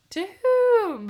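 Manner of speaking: high, loud hooted question; mock owl hoot. Co-host turns the owl's cry grammatical, mock hooting.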